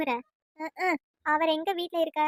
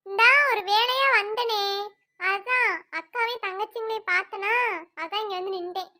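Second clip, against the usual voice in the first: visiting woman; 3 semitones higher than usual. Acted. Vanasa telling the sisters why she came, higher and sweet. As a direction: explain the visit a bit too sweetly.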